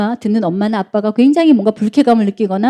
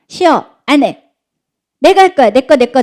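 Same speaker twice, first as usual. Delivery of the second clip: put-on bratty toddler refusing everything; 4 semitones higher, 5 dB louder than usual. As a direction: play a stubborn three-year-old, high and loud. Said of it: "미운 세 살 때 애들이 제일 많이 하는 말". (떼쓰는 세 살배기 말투)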